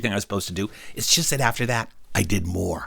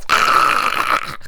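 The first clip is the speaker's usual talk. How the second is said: drawn-out voiceless rasping cry; imitated monstrous witch noise; long creepy breathy screech; witch hiss. Teachers turn like witches.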